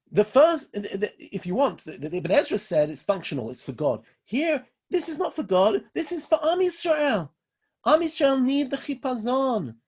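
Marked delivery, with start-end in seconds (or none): 4.2-9.8 high-pitched, fervent preaching; impassioned insistence. Real, not acted. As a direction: lift the voice high to drive the point home. Preacher insisting on his point.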